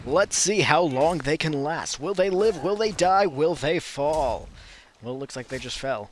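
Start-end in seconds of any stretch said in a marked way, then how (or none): 0.1-4.5 dramatic announcer. Acted. Mock commentary on the fight.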